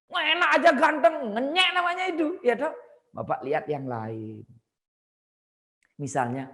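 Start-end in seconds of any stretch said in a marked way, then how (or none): 0.1-2.7 louder and higher, offended; offended retort. Retold or imitated. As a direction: snap back as the insulted friend. Acting the offended friend. (teman yang tersinggung membalas dengan marah, lebih keras)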